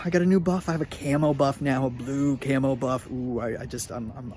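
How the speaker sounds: ordinary talk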